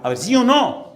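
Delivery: loud, high preacher's challenge; forceful challenge. Real, not acted. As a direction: call out the congregation at full voice. Predicador reta a la congregación. (reto de predicador a toda voz)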